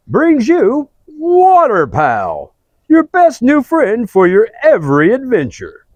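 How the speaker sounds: loud, hammy ad announcer; commercial announcer. Acted. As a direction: sell it big like a TV ad. Fake commercial pitch.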